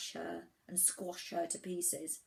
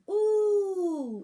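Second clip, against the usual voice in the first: high, loud long ooh; swooping ooh from an impressed crowd; awed "ooh"; 13 semitones higher, 12 dB louder than usual. A narrator's reading. Islands marvel at the falling wig.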